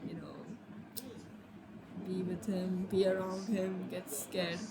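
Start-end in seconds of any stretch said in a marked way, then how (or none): none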